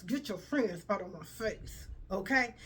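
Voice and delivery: usual speaking voice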